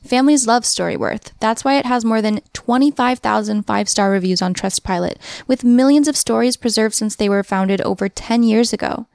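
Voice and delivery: ad read. Acted. Host reads a sponsor ad.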